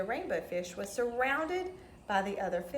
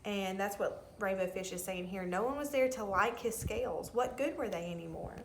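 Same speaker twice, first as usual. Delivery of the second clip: ordinary delivery, nothing marked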